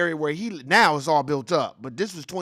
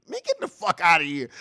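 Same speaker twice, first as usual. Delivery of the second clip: laughing in disbelief; 9 semitones higher than usual. Real, not acted. Streamer reacting to the defense, laughing. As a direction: laugh it off as absurd.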